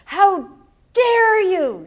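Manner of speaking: high, loud how dare you from a hurt widow; indignant outburst. Retold or imitated. Widow confronting the pastor, indignant high loud outburst. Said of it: "she said emphatically".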